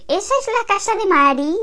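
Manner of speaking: voz de señora mayor, mucho más aguda; señora despistada. Acted. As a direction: pregunta confusa y aguda de vieja. Señora despistada que se equivoca. (señora que se ha equivocado de piso, aguda y despistada)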